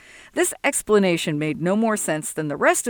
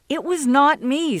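higher, firm denial; insistent denial; 7 semitones higher than usual. Acted. Sol to Jesse, a higher firm denial. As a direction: refuse the blame outright. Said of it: "Sol insisted".

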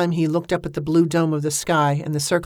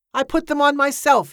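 high-pitched indignant insistence; insistent; 9 semitones higher, 4 dB louder than usual. A narrator's reading. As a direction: protest it, voice raised in pitch. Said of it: "Hillsgrove insisted".

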